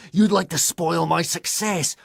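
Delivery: loud, high resentful voice; angry accusation. A narrator's reading. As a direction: hurl the accusation. Mac accuses Banksy angrily.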